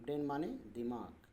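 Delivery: usual speaking voice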